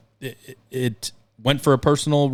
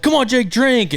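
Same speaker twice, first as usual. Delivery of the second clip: high, pushy urging; peer pressure voice; 13 semitones higher, 3 dB louder, slower than usual. Retold or imitated. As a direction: egg him on like a pushy friend. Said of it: "People were like".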